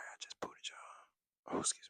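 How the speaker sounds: hushed, sheepish apology; embarrassed whisper. Real, not acted. Creator whispers sorry to viewers after burping, embarrassed hush. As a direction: whisper it, caught out after a burp.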